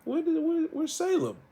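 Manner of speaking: high, rising puzzled where's Salem; impatient surprise. Retold or imitated. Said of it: "I was like".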